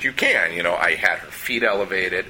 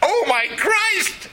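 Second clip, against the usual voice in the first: high, slow, horrified outcry; shocked exclamation; much slower than usual. Retold or imitated. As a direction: gasp in horror at the bloody sight. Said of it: "she's like".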